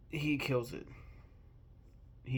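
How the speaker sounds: ordinary delivery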